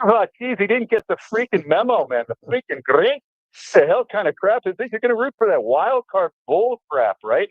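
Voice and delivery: Scottish dad. Retold or imitated. Scottish dad outraged at the guest's Raiders jacket, high mock Scottish accent. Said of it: "he had that hard Scottish accent".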